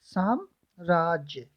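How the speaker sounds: साम्राज्य slowly in two parts, "saam... raajya"; syllable by syllable. Shown as a demonstration. Teacher spelling out the answer for students, slow and segmented. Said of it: "क्या होता है?".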